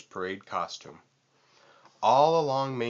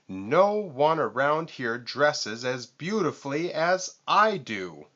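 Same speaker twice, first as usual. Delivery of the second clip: boasting; 5 semitones higher, 4 dB louder than usual. A narrator's reading. Vulture boasts.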